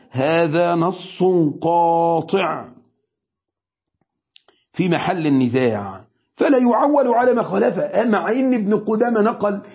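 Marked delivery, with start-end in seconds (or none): 0.1-8.0 slow weighty quote. Shown as a demonstration. Sheikh repeating Ibn Qudama's ruling to students, slow and emphatic. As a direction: proclaim it as a final ruling. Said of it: "الكلمة دي تدرس".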